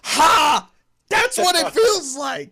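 high, loud, drawn-out crowing; imitating a gleeful vindicated yell; gloating cheer. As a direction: shout it in hammed-up triumph, laughter breaking in. Retelling Loki's gleeful outburst.

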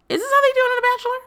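high, fast mock voice. Repeated in put-on voice.

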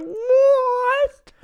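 very high, drawn-out incredulous squeal; hammed-up high-pitched dismay; mock disbelief. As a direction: wail it in exaggerated shock. Retelling reaction to release date.

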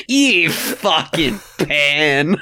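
slow, drawn-out mock insult; like a slur. Teasing the co-host's accusation, said like a slur. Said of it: "say it like it's a slur".

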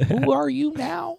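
very high, slow mock sob voice; mock crying. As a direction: wail it like a hammed-up crier. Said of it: "I started crying 'cause I'm just like".

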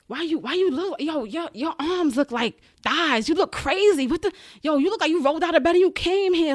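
a lightly put-on inmate jeering; mocking inmates. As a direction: throw their insults back at her like the inmates did. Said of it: "they talk to you so crazy".

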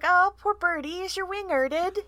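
put-on high, sympathetic little-kid voice; small child dormouse. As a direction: coo it high to a hurt bird. Voices small child Dumble to bird.

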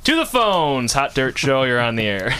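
loud upbeat DJ phone pickup; radio host phone greeting. Acted. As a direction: answer the call like a DJ. Host answering call on air.

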